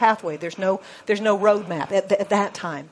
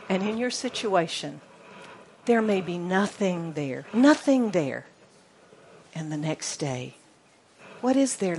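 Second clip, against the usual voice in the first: usual speaking voice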